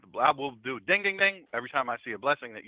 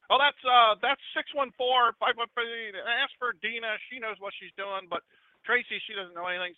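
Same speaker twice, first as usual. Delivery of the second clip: hollering from afar; 6 semitones higher than usual. Retold or imitated. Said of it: "would holler out to you".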